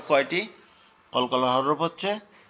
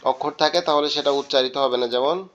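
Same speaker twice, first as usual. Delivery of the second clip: usual speaking voice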